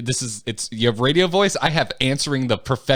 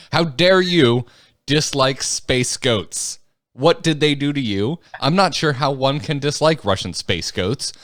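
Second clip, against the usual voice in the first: dramatic email reading; 3 semitones higher than usual. Acted. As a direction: read the complaint with mock outrage. Reads listener email.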